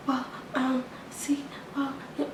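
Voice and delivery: mock the clerk fumbling for words; hushed stammering imitation; whispering clerk. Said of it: "she's still whispering".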